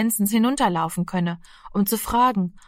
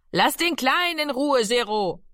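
Rufen; 6 semitones higher than usual. A narrator's reading. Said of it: "rief Mami".

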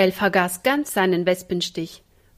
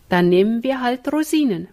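ordinary delivery, nothing marked

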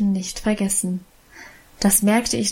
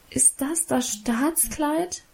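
higher, softer and slow, crestfallen; quiet, hurt reproach; disappointed, reproachful; 5 semitones higher, 5 dB quieter, slower than usual. Acted. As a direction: ask it let down and accusing. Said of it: "fragte ich enttäuscht und vorwurfsvoll".

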